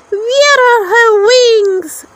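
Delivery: high, loud, slow scoffing cry; mocking lady beetle. A narrator's reading. Lady beetles mocking Thumbelina's missing wings, high jeering cry. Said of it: "the lady beetles cried".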